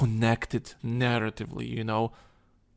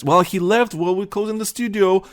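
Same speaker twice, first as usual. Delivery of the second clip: higher, dismissive EA spokesman impression; mock corporate voice; 6 semitones higher than usual. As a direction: brush it off like a bored company rep. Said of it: "E A just basically says".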